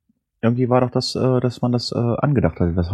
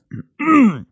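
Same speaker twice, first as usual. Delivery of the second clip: sharp choking throat clear; swallowed wrong way; 13 semitones higher, 5 dB louder than usual. Real, not acted. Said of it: "Hab mich verschluckt".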